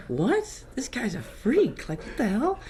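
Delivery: mock teen girl calling him a freak; lightly voiced girl, disbelieving; classmate girl. Classmate girl reacts, retold.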